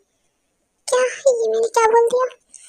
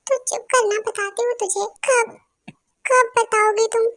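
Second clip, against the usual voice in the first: ordinary talk, nothing marked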